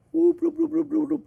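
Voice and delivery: squeaky high whoop whoop, tossed off for fun; playful high whoops. Acted. Cook showing off straight bacon, playful high whoops. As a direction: chirp it up high like a cartoon.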